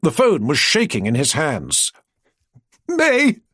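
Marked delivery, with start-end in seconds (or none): 2.9-3.4 high squeak of a name; strangled high-pitched squeak. Acted. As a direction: squeak it out, barely holding in laughter. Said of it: "Sparks squeaked".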